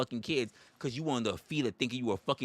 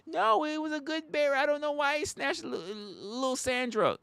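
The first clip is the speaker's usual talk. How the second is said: high, innocent whiny voice; mock naive bear defender; 13 semitones higher than usual. Comic mocks a parent defending the bear, high. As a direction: plead it high and clueless.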